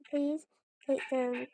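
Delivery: ordinary talk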